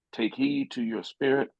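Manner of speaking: normal talking voice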